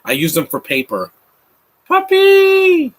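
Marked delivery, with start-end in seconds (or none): 1.9-2.9 high, loud, drawn-out squeal; excited call. Real, not acted. Spotting a puppy mid-commentary, excited high squeal. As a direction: squeal it in a big high burst.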